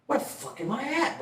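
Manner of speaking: hushed, high self-quote; disoriented. Retold or imitated. Sitting up as everyone stares after the seizure, hushed confusion.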